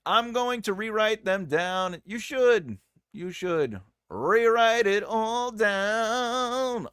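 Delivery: high, loud, drawn-out mock declaiming; hammed-up bellowing. Host urging a viewer to rewrite cards, hammed-up bellowing. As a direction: bellow it with a long held ending.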